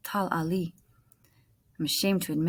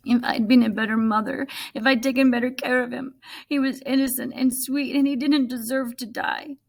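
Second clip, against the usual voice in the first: higher and louder, grief spilling out; distressed lament; 6 semitones higher, 6 dB louder than usual. Acted. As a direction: cry it out as a heartbroken mother. Mother grieving dead goat.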